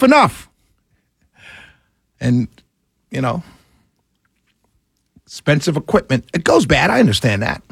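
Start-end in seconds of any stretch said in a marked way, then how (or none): none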